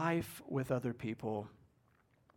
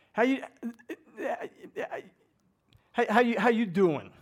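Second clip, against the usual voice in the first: put-on louder, stammered Brooklyn accent greeting; a hammed-up Brooklyn "how you doin'"; 7 semitones higher, 6 dB louder than usual. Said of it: "Like you're from Brooklyn".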